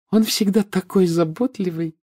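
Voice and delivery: put-on high-pitched, cooing girlfriend; admiring purr. A narrator's reading. Fiancée admires her thoughtful boyfriend, cooing. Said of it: "восхищённо промурлыкала Флёр".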